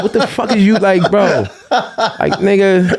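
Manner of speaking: real laughter through the words; laughing in disbelief. Real, not acted. Reacting to a clip, laughing as he talks. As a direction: laugh while asking what that was.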